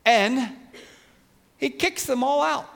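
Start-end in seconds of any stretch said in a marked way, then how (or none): none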